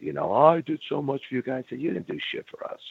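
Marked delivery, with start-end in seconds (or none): 0.3-1.7 higher, indignant mock of the boss; a touch of a defensive boss; mimicking angry Vince. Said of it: "he started getting mad". 1.8-2.8 hissed retort. Retold or imitated. Retold confrontation with boss.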